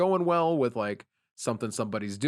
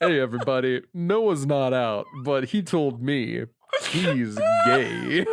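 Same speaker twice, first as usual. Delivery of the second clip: slow, louder mock outing announcement; 3 dB louder, slower than usual. Pretending a friend outs Noah to everyone, mock announcement. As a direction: announce it like gossip to a crowd. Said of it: "And it's just like".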